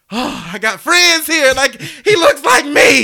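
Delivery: high, loud, slow overjoyed cry; excited kid. Retold or imitated. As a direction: burst out like you found a friend. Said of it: "and I was like".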